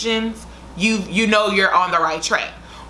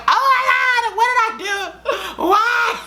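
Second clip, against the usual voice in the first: mock tantrum wail; 14 semitones higher than usual. Retelling the tantrum on the floor to viewers, mock crying wail. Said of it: "kicking and screaming like".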